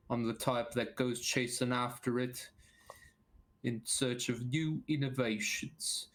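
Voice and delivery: rambling Cockney man of science; Cockney accent. Acted. Biologist introducing himself to the party, Cockney accent. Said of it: "your Cockney is better than my Southern".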